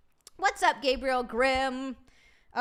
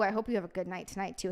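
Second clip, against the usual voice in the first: ordinary talk, nothing marked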